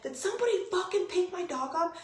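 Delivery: high, strained, outraged question; retold alarm. Owner asking Josh whether the dog was taken, retold alarm.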